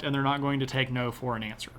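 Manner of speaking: normal talking voice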